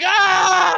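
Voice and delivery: high wailing "ah"; high-pitched kid's scream. Retold or imitated. Crushed child under the timber, high-pitched wail. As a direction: cry out like a hurt little kid. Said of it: "in, like, a high-pitched voice".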